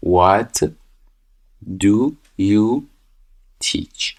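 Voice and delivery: normal talking voice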